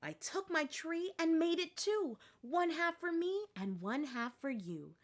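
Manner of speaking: soft, hushed rhyme. Acted. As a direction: rabbit softly recites the sharing rhyme. Rabbit whispering the gift rhyme.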